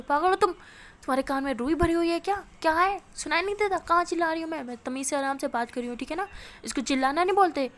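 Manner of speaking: loud, high, exasperated tirade; full angry yell, firing off questions; shouting. A narrator's reading. Anu furiously insisting this is not yelling, shouting. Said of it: "मेरे ख्याल से तो इसको चिल्लाना बोलते हैं".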